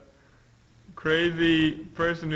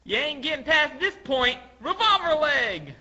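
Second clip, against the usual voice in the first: high, raised battle voice; high cartoon fighter's challenge; attack call; 11 semitones higher, 4 dB louder than usual. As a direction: shout a warning, then draw out the move name. Acted attacker calling out her move.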